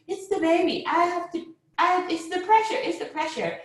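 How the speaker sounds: high-pitched retold alarm; acted urgency. Mother in labor warning staff of pressure, acted high urgency.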